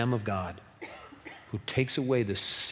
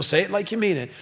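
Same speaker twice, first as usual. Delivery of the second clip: high, insistent mock-parent voice; pitched-up parental order; scolding parent; 8 semitones higher, 4 dB louder, faster than usual. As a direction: act out the parent's familiar demand. Parent's drill acted again.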